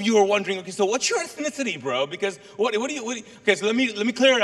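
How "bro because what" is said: usual speaking voice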